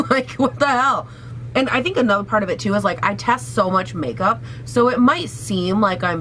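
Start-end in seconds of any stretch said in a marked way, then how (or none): none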